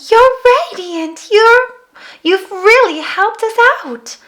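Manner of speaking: high, bright admiring voice; sweet mermaid. Acted. Mermaid praising the girl, admiring. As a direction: gush with admiration. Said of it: "The mermaid said".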